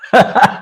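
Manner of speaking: high, loud burst of laughter; real amused cackle. Real, not acted. Laughing at criticism of accent.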